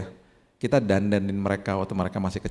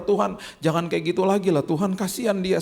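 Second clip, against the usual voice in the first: pleading prayer; 9 semitones higher than usual. Retold or imitated. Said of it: "saya berdoa".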